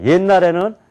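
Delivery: ordinary talk, nothing marked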